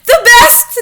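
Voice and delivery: high, loud excited witch cry; high loud cheering witches; cried out. A narrator's reading. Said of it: "they cried".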